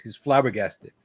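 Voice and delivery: ordinary delivery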